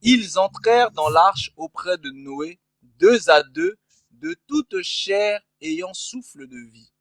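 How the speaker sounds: slow, solemn Bible reading; scripture reading. Acted. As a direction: read the verse slowly and formally. Reads Bible verse aloud.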